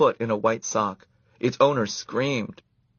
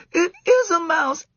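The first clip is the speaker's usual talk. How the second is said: put-on high, loud squealing girl; 6 dB louder than usual. A narrator's reading. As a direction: shriek it like a startled camper. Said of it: "squealed Karen".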